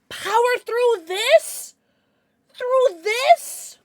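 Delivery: high, loud, slow disbelieving question; incredulous. Real, not acted. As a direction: scoff at the absurd suggestion. Told to down a big bottle.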